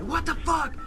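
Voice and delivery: high, loud panicked what the fuck; buddy shocked as his hoodie catches fire; shocked yell. Retold or imitated. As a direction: yelp it as the flames catch. Hoodie bursts into flames.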